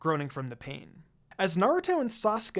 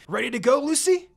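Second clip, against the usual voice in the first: put-on high, loud, bouncy boy voice; eager Naruto; 7 semitones higher, 7 dB louder than usual. A narrator's reading. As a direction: burst in at the door all keyed up. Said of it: "he says".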